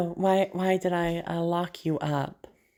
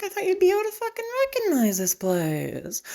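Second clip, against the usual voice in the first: mock hurt; 14 semitones higher than usual. Patient mock-hurt toward doctor.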